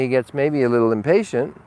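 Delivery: ordinary delivery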